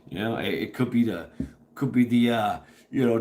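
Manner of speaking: ordinary talk, nothing marked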